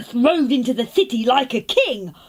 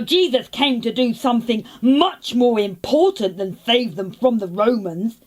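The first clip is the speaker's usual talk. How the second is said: ordinary talk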